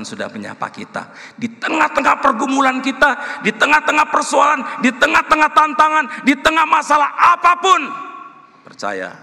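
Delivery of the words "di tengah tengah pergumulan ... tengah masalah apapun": loud, high rallying shout; full-force preaching. Real, not acted. Preacher urging congregation amid their struggles, impassioned shout. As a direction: thunder each phrase at the congregation.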